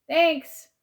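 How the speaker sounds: high, loud quick thanks; calling out. A narrator's reading. Child to aunt after finding tablet, calling out. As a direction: shout thanks across the house.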